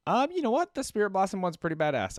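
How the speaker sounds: jumps up high and loud with enthusiasm; suddenly thrilled by the artwork; excited burst. Real, not acted. Gamer reacting to the Spirit Blossom skin, excited.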